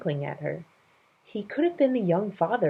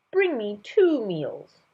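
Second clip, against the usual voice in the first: slow louder command; regal order; 6 semitones higher, 5 dB louder, slower than usual. Acted. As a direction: order it deliberately. King orders slowly.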